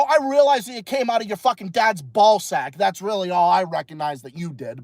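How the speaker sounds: normal talking voice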